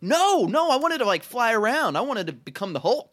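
high, loud retold protest; wailed mock disappointment; frustrated outcry. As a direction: act out loudly begging to stay in the dream. Retelling his protest on waking from dream.

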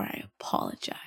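whispered sarcastically; mock whisper. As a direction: breathe it out as a mocking whisper. Mocking the coworker's unsaid apology.

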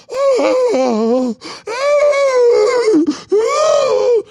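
high, loud hammed-up wailing; mock crying. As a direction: wail it like a fake sob. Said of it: "and went".

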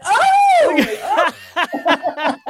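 loud, high "oh!" of celebration; high crowd-style roar, laughing; delayed goal cheer. Retold or imitated. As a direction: belt out a mock football cheer.

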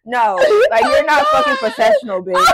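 high, loud telling-off; heated scolding. Real, not acted. Telling off co-host during recording, heated scolding. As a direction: scold at full pitch. Quarrel about recording properly.

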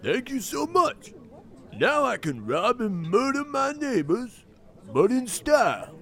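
overly sweet fake thanks; fake gratitude. Criminal thanks for signed crowbar.

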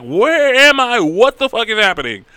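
high, loud, frightened questions; panicked confusion. Acted. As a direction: cry out lost and scared. Revived woman panicking, acted quote.

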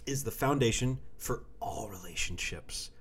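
hushed whisper. Real, not acted. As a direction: breathe it like a secret. Whispered pronouncement.

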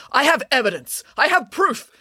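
high-pitched, insistent declaration; urgent protest. Acted. Man in a headlock announces his evidence, urgently protesting. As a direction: plead your case, voice pitched right up.

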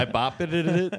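laughing through the words. Real, not acted. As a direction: say it while cracking up.

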